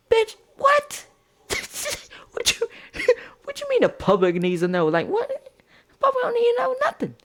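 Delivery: squealed high disbelief, fast; outraged disbelief. Retold or imitated. Outraged at the ex's remark.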